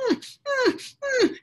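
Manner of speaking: high whimpering hum; whiny dog whimper. Retold or imitated. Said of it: "like a whiny".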